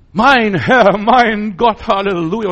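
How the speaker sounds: high, loud ecstatic exclamation; overwhelmed cry of faith. Acted. Thomas overwhelmed touching Jesus.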